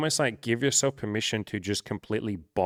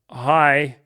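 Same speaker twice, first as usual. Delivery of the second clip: mock loud hi; 5 semitones higher, 11 dB louder than usual. Shown as a demonstration. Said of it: "You just say".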